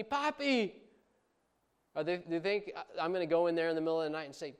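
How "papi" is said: put-on very high child's call of Papi; child yelling. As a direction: cry out Papi like a little kid. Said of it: "afraid to yell out to me".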